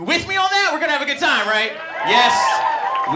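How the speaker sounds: high loud rallying call; crowd hype shout. Real, not acted. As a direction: get them shouting back. Pumping up the crowd.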